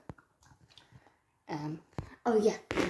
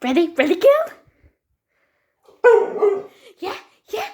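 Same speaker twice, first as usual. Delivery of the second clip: much higher and louder, rising squeal; excited pet talk; 7 semitones higher, 6 dB louder than usual. Real, not acted. Owner winding up her dog before the throw, high squeal.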